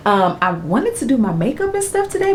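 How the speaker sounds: ordinary talk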